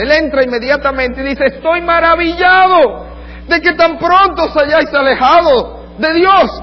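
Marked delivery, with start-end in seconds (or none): 1.5-6.6 slow, loud, high outrage; indignant Paul. Retold or imitated. Paul rebuking the Galatians for leaving God, slow indignant shout. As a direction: drag out the shock, loud and high. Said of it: "Pablo estaba tan indignado y estaba tan conmovido".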